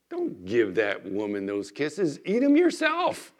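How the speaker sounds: hammed-up selfish inner voice, slow; voice of the flesh. The flesh prompting him to keep the kisses, hammed-up. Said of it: "'cause in my flesh".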